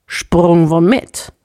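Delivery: slow, baffled question; perplexed. A narrator's reading. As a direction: ask it completely puzzled. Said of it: "fragte Kuska perplex". (verblüfft und langsam gefragt)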